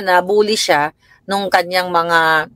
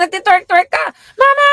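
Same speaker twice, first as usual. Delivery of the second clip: tearful shouted confrontation; 12 semitones higher than usual. Retold or imitated. Mother scolding daughter, retold.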